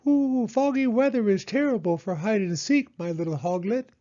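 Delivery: high, cooing owl; Owl's voice. Acted. Owl talking to Hedgehog about hide and seek, high and cooing. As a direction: coo it like a silly owl.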